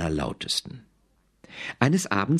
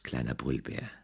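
softer, tender mother voice; mother's goodnight; 5 semitones lower, 8 dB quieter than usual. A narrator's reading. The mother saying goodnight to her cub, soft and tender. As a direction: say kleiner Brüllbär softly like a mother tucking in. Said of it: "sagte die Mutter".

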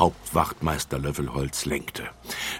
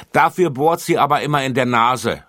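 put-on a boy calls out, raised and loud; calling out; 9 semitones higher, 10 dB louder than usual. A narrator's reading. Boy calls out to tell on Sonja, loud. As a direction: blurt it out like a cheeky boy. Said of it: "rief ein Junge".

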